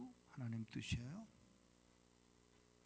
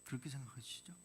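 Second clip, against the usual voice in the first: ordinary delivery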